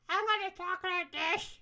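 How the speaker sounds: stiff demo of a slur; anti-lisp voice. Shown as a demonstration. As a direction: talk like the tongue is cut. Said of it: "so it's more like".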